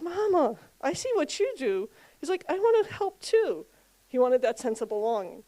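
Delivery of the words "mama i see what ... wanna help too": put-on little boy voice. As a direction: lightly voice an eager six-year-old.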